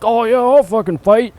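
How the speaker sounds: mock the biker squaring up. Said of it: "he's just like".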